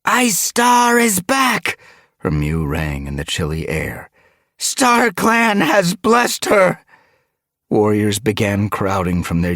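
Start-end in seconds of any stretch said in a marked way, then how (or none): 0.0-1.8 high, loud, drawn-out announcement; calling out. A narrator's reading. Duskfur wakes the sleeping clan, calling out. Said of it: "Duskfur called to the sleeping clan". 4.6-6.8 high, loud, drawn-out announcement; calling out. A narrator's reading. Duskfur wakes the sleeping clan, calling out. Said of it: "Duskfur called to the sleeping clan".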